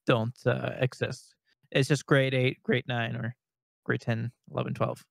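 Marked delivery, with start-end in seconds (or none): none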